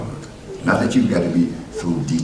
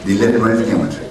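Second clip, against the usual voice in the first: loud preaching; 4 semitones higher, 4 dB louder than usual. Acted.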